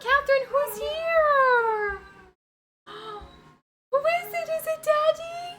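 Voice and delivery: high, squeaky excited voice to the dog. Real, not acted. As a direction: coo it like talking to a puppy. Excited baby talk to dog.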